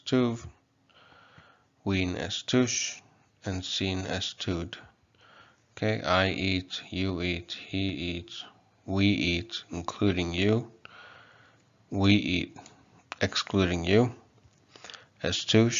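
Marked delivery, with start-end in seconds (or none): none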